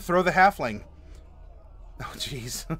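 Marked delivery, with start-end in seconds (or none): 2.0-2.8 amused laugh at a meme; a soft laugh breaks out. Real, not acted.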